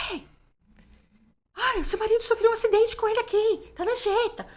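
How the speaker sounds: high, agitated stranger yelling bad news; man shouting on the phone. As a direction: play the panicked caller shouting down the line. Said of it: "Um cara gritando no telefone". (homem gritando alterado ao telefone)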